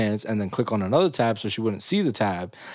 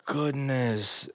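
stunned; 3 semitones higher, much slower than usual. Retold or imitated. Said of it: "I was like".

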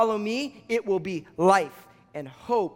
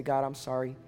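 low, soft, earnest prayer; 4 semitones lower, 4 dB quieter than usual. Real, not acted. Preacher leading people back to God, praying softly. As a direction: pray it quietly, with pauses between phrases.

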